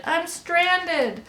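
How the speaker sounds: high, drawn-out distress call; shouted plea. A narrator's reading. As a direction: call out stranded, pitched high. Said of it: "he shouted".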